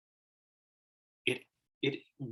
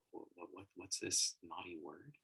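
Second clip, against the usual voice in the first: very soft, lower voice; furtive hush; 4 semitones lower, 10 dB quieter than usual. Acted. Kids asking each other about a swear word, furtive hush. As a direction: ask it secretly under your breath.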